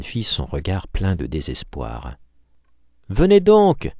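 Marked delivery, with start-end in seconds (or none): 3.1-3.9 high, loud cry after him; calling out. A narrator's reading. The girl calling the hunchback back, loud cry. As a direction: call him back urgently. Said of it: "cria-t-elle".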